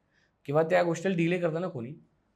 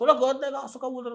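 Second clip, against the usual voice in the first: higher, mock-irritated daughter scolding; imitated irritable snapping; 9 semitones higher, 5 dB louder, faster than usual. Boyfriend imitates her snapping at her parents, irritable. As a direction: snap it as an imitation, high and loud at first. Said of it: "चिडचिड करते ना".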